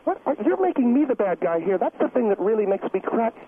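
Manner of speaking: normal talking voice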